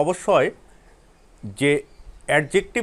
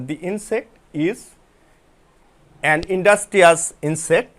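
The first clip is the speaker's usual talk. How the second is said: slow dictation; much slower than usual. Shown as a demonstration. Teacher reading example sentence slowly.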